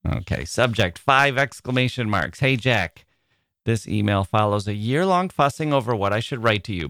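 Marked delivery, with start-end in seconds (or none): none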